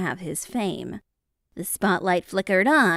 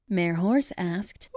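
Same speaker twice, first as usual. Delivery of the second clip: plain reading voice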